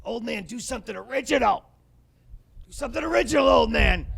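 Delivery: rising to a high, loud shout at his father; exasperated kid shouting "do something original"; kid yelling at dad. Retold or imitated. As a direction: demand it like a fed-up six-year-old. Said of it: "I was just like".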